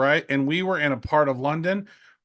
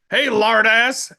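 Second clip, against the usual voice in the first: loud, slow mocking call; drawn-out teasing shout from a stepdad; loud slow jeering nickname; stepdad's taunt; 6 semitones higher, 7 dB louder, much slower than usual. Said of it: "that's what my stepdad always used to call me".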